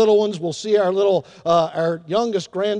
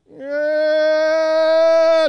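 high, drawn-out mock infant wail; long rising-volume baby bawl; imitated baby cry; 7 semitones higher, 4 dB louder than usual. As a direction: let a baby's cry swell. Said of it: "they are just crying".

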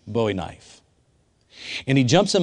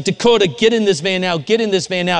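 urgent shouting; 7 semitones higher, 5 dB louder than usual. Retold or imitated. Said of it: "Tim was like".

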